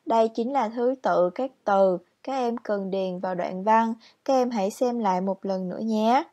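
ordinary delivery

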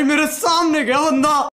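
ordinary talk, nothing marked

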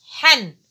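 teacher sounding tanween; tanween fatha. Shown as a demonstration. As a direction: sound out حن as ḥan. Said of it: "ماذا أقول له؟".

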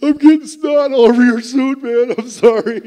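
high, loud, tearful blubbering apology; choked-up wail of embarrassment; crying apology. Retold or imitated. Retelling crying on Jagjit's suit.

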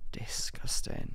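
slow, soft whisper; hushed mock disapproval; whispered disgust. Real, not acted. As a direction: draw it out in an appalled whisper.

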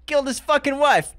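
high, loud mock outrage; laughing, over-the-top exclamation; comic outburst. Comic outburst after the comment.

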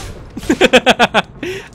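long, chuckling laugh; gleeful laugh. Real, not acted. Player after setting off a tank, gleeful laugh. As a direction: laugh with glee at the kill.

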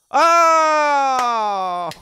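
loud high "aah", drawn out and sliding down; long loud scream. Real, not acted. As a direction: scream out at the reveal. Reacts to the card reveal.

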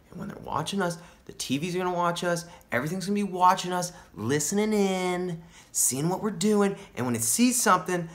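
high, insistent paranoid rant; paranoid alarm. Acted. Stoner warning friend that TVs will watch us, paranoid alarm. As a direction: keep the pitch up as the paranoia spirals.

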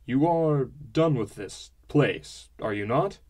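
slow, firm demand; pressing father. A narrator's reading. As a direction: lean on him slowly. Father pressing his son.

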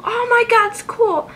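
ordinary talk

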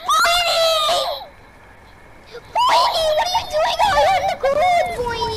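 high, loud Brooklyn guy calling; brooklyn accent. Pretend. Calls in put-on Brooklyn voice.

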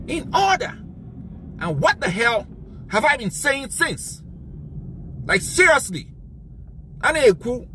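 exasperated, demanding they act; angry rant. Real, not acted. Heated rant at audience.

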